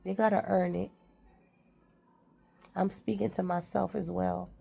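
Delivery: ordinary delivery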